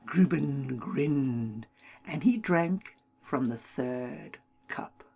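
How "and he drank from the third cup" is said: usual speaking voice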